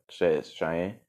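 ordinary delivery

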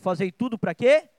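loud question rising sharply at the end; emphatic prompt. Real, not acted. Preacher asking the congregation to complete the verse, emphatic call-out.

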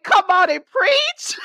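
high, loud, slow cheer. Retold or imitated. Host retelling her reaction to a promo, loud cheer. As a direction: egg her on like a hyped fan. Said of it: "I was like".

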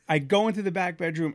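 normal talking voice

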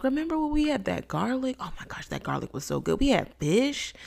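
lightly voiced, high, wistful reminiscing; dreamy nostalgic Israelites. Israelites longing for Egypt's garlic, lightly voiced wistful. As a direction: gush a little over the old food. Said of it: "They were like".

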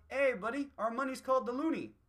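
put-on light, high, friendly Canadian accent. A friendly Canadian boasting about money, light Canadian accent. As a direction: chirp it in a cheery Canadian accent. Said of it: "the ones who are going".

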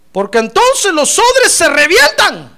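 versículo fuerte, agudo y lento; proclamación bíblica. Acted. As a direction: proclamar alargando cada frase. (lectura de predicador a plena voz)

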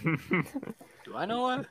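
high, drawn-out innocent question; mock innocence. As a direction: play dumb, slow and high.

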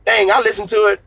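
mock surprised realization. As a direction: act out someone suddenly catching on. Acting out listeners' belated realization.

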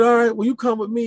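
officer ordering the recruit, high command. Retold or imitated. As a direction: summon him firmly. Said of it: "He said".

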